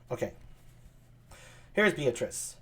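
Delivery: ordinary delivery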